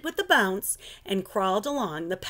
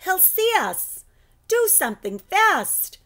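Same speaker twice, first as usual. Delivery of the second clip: put-on higher, louder, urgent kid's cry; frightened girl shouting to her teacher; panicked child's yell; 11 semitones higher, 5 dB louder than usual. A narrator's reading. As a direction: call it out in alarm. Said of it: "she yelled".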